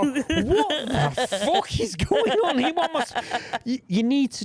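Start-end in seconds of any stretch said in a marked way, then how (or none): 0.0-3.2 high, slow, laughing confused brain. Retold or imitated. The listener's brain hit by too many instruments, laughing confusion. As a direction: play the overloaded brain, cracking up. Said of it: "your brain's gonna go".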